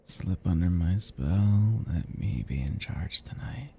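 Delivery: slow, soft lull fading into whisper; hypnotic. Acted. Vampire putting victim under a spell, hypnotic. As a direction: coax them gently under your control.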